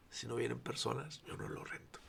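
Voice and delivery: soft unvoiced vow; whispered ultimatum. Pastor retells his last-month deal with God, whispered. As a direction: breathe the words like a private vow.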